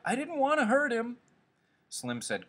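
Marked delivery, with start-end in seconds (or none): 0.0-1.2 very high wail of remorse; distressed cry. A narrator's reading. Whimpering Lenny regrets crushing Curly's hand, crying out. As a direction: wail the excuse at a high pitch. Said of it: "Lenny cried".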